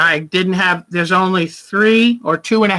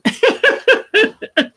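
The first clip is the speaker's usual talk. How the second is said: high chuckling laugh; a real high laugh in a quick run. Real, not acted. Host laughing at her own housework remark, high chuckle.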